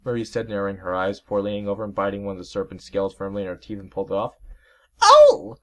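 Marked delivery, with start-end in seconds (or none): 5.0-5.6 very high, very loud yelp; shrill hurt cry; pained yelp. Acted. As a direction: yelp of sudden pain. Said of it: "the serpent yelled".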